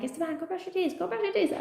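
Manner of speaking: tired mother nagging her son; lightly voiced nagging parent; nagging mom. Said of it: "instead of being like".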